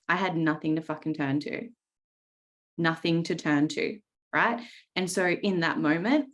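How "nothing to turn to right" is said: usual speaking voice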